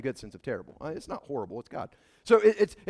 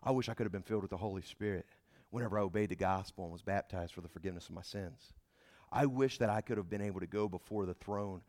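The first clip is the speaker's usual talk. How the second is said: much softer, lower, fading to a whisper; wistful longing; 10 semitones lower, 11 dB quieter, faster than usual. Retold or imitated. Ancient believers imagining our age, softly and longingly, ending in a whisper. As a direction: sigh it out as a dreamy envious believer. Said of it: "are thinking like".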